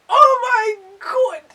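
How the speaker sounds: high, loud gushing exclaim; swooning cry. Real, not acted. Swooning at the memory of his accent, loud and high. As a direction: melt over his accent.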